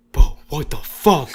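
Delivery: retold disbelief. Cousin reacts to the date's looks.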